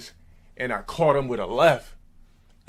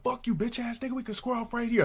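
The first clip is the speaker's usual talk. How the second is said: high, softer mock-angry challenge; retold challenge; 12 semitones higher, 4 dB quieter than usual. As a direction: imitate a rival demanding a fight, pitched way up.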